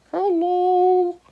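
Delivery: high squeaky bird hello; pelican voice. A narrator's reading. Pelican greets the arrivals, high bird voice. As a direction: chirp it like a bird.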